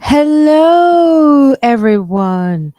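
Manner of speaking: loud, high, drawn-out hello; big greeting. Real, not acted. Host opening her livestream to viewers, big loud greeting. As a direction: belt out a long welcome to the crowd.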